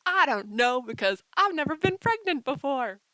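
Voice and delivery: loud, high, frantic mock panic; mock panicked shout. Vlogger yelling at viewers in mock panic about pregnancy. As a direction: shout it in playful alarm.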